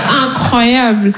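very slow, dragged-out "Incroyable"; drawn-out. Shown as a demonstration. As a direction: stretch "Incroyable" out slowly. ("Incroyable" étiré très lentement)

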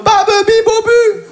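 high silly babbling; mock baby talk. Imagining himself at the tenth session, high mock baby babble. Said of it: "J'arriverai en mode".

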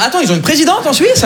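high-pitched voice of disbelief; mock astonishment. French crowd discovering Switzerland's president, mock astonishment.

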